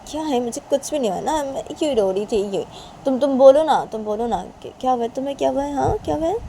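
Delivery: high-pitched denial turning to concern; mother deflecting. Acted.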